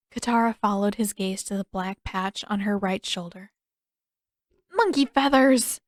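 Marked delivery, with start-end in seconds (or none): 4.7-5.8 loud, higher dismayed exclamation; mock curse. Katara finding ink on her shoulder, loud dismay. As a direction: blurt it out in dismay.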